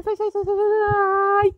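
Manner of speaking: sai aigu et traîné, paniqué; chasse-le en couinant; supplication aiguë. Real, not acted. Chasse le bébé, voix aiguë.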